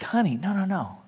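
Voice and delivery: quiet loving husband, earnest; tender reassurance. Retold or imitated. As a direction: soothe her doubts quietly. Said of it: "he's like".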